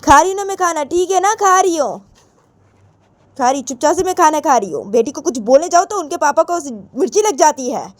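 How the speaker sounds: high-pitched, huffy mother; indignant mother. Acted. Mother complaining that papa sides with daughter, indignant.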